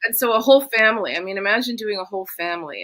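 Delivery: ordinary delivery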